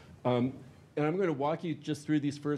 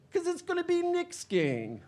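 much higher sly cartoon voice; gloating crook. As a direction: gloat as you steal the club. Nick gloating.

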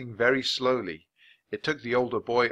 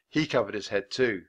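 usual speaking voice

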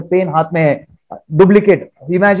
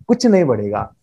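normal talking voice

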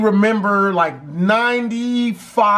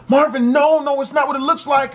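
high imitated girl's voice; raised, pleading mock female voice; pleading girlfriend; 8 semitones higher than usual. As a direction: act out a girlfriend's urgent denial. Girl pleads with boyfriend.

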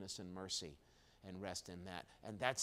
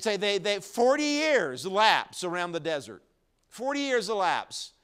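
much louder and higher, pressing each word; fervent loud pulpit proclamation; preaching at full force; 7 semitones higher, 13 dB louder than usual. Real, not acted. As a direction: drive it home to the congregation. Preacher building to climax.

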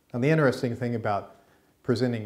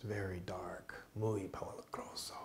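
whisper; 2 semitones lower, 14 dB quieter than usual. Pretend. Spooky walk into dark forest.

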